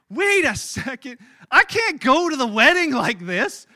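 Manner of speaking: high and loud, slowing on wait a second; sudden panic dawning on him; alarmed realization. Retold or imitated. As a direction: groom realizing he can't go to the wedding like this.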